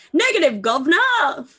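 a clipped-free, mildly hammed British toff; posh British accent. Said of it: "That's how they all sound".

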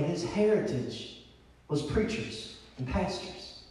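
very soft, low and measured; hushed preaching. Real, not acted. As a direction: let long pauses hang between phrases. Sermon drops to solemn hush.

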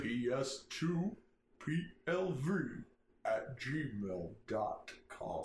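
very slow, spelled-out email; slow and clear. Shown as a demonstration. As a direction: spell it out slowly. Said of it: "let me try that one more time slower".

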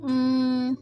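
ordinary delivery

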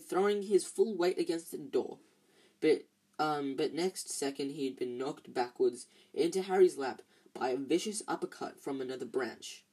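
plain reading voice

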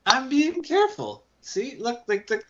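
high-pitched, protesting character voice; in-character Raleigh. Acted. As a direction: pitch it up in defensive insistence. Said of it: "Raleigh's like".

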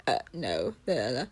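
sing-song la la la, ignoring them on purpose, lightly mocking; dismissive attention-seeker. Imitating someone who ignores people talking to them, in a low sing-song. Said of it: "it's like".

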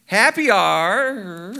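very slow, hesitant. Shown as a demonstration. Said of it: "I'll read it for you".

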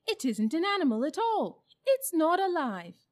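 high, loud, insistent outcry; loud contradiction. A narrator's reading. Several animals contradict the bulldog, loudly insistent. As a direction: declare it forcefully, pitched well up.

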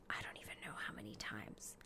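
突然压低成耳语; whisper. Acted. Sudden whispered aside.

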